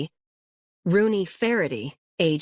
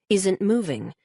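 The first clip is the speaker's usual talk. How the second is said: ordinary delivery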